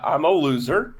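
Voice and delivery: ordinary talk, nothing marked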